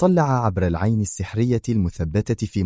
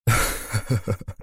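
short amused chuckle; a quick friendly laugh; Qadri laughs; 4 dB quieter than usual. Acted. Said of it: "ضحك قدري".

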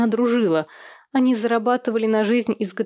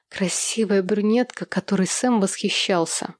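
ordinary delivery, nothing marked

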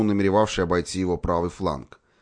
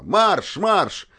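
high, loud command to charge; battle command; 12 semitones higher, 4 dB louder than usual. A narrator's reading. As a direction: call the charge.

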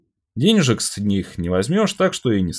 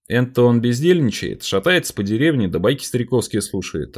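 plain reading voice

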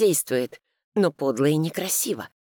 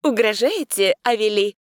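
cheerful; 6 semitones higher, 4 dB louder than usual. A narrator's reading. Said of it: "весело спросила".

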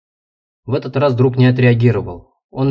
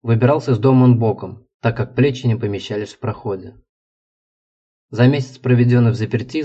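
plain reading voice

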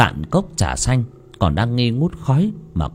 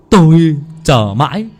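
gleeful; 10 semitones higher, 5 dB louder than usual. Acted. Servant gloating over death.

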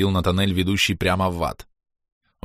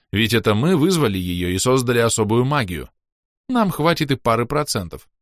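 usual speaking voice

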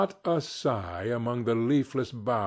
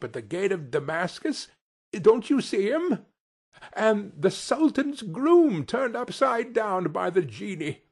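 high, excited storybook delight; acted childlike excitement, pitched up; between laughing and crying; 7 semitones higher than usual. A narrator's reading. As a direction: point out each old friend with rising glee. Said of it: "between laughing and crying".

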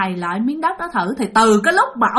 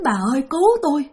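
higher, pleading ghostly cries; pitiful wailing; 6 semitones higher, faster than usual. Retold or imitated. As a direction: moan for help from beyond. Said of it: "kêu thảm thiết vậy".

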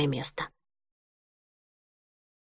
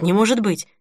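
ordinary delivery

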